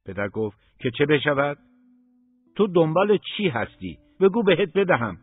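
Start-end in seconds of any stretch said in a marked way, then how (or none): 0.8-1.6 scornful father. Acted. Father scorns son's plans. 2.5-5.2 high and loud, biting each word; angry shouted question. Acted. Father shouts at son.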